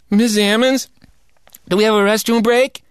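put-on high, eager kid's voice asking permission; boy pleading with the teacher for a break; pleading schoolboy. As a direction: play the boy begging the teacher. Boy asks teacher for bathroom.

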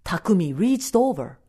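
ordinary delivery, nothing marked

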